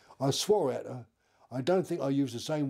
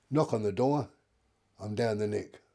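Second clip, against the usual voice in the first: ordinary talk, nothing marked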